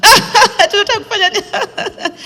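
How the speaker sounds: high, laughing disbelief; laughing mock question. Directors laughing at her stand-up plan, mocking question. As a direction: ask it through laughter, mocking the idea.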